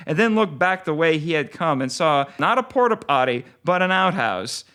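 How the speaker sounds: ordinary delivery, nothing marked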